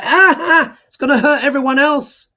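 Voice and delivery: high, loud, gloating mock voice; mocking Trump voter. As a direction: sneer it out as a smug greedy voter. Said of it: "They were like".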